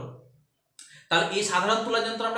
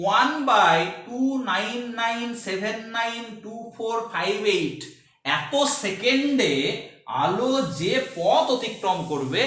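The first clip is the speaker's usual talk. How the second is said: drawn out, emphatic dictation; measured teacher pace for note-taking; slow dictation; 3 semitones higher, much slower than usual. Real, not acted. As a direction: spell it out slowly, figure by figure. Teacher dictates a definition slowly.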